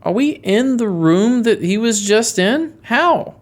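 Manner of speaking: high-pitched, incredulous questioning; startled and baffled; shocked disbelief. Acted. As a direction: ask it slowly, rising into a high 'How?'. Character finds himself in crime room.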